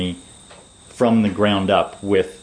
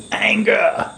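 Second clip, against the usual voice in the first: put-on thick Australian accent; 10 semitones higher than usual. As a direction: bark it in a broad Australian accent. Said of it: "he says in his thick Australian accent".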